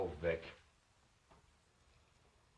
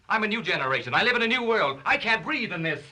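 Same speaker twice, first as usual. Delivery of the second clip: rising outburst; 9 semitones higher, 4 dB louder than usual. Acted. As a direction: voice climbs higher and louder. Outburst building against sister.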